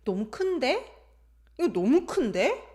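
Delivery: 큰데 swept upward like a question; surprised lift at the end of 큰데; rising intonation. Shown as a demonstration. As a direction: end each 큰데 on a high rise. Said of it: "Then rising".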